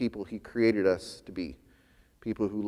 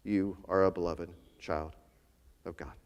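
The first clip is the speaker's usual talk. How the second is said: put-on "You are a beloved child of God" said lower and softer, gently; 5 semitones lower, 7 dB quieter than usual. Pastor voicing God's gentle words, lower and softer. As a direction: voice it like a tender hush from God. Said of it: "the one who is whispering to us".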